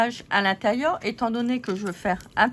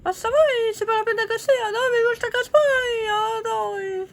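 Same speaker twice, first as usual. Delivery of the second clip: high squeaky paper-doll voice, bickering; squeaky puppet lady; 13 semitones higher, 4 dB louder than usual. Acted. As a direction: play a cheeky little figurine squabbling with her neighbour. Voices paper ladies squabbling.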